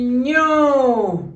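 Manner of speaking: ÑO released long from the held sound, falling; stretched, emphatic ÑO; syllable blending. Shown as a demonstration. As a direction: swell into ÑO as the sounds collide. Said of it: "hasta que choquen".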